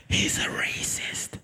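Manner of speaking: slow, hushed whisper; confiding whisper to a classmate. Retold or imitated. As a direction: let it out as a secret. Friend voiced confiding.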